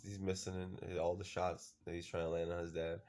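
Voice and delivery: ordinary talk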